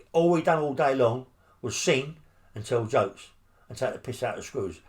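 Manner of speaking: usual speaking voice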